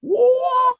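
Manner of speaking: high, loud "whoa"; mock drugged whoa. Host mimicking a man taking magic fire, high loud whoa. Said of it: "take some fire and be like".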